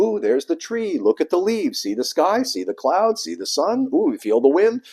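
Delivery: put-on high, excited sing-song baby talk; parent showing a baby. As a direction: point out each wonder to an infant in a high, lilting voice. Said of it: "you hold them up and you go".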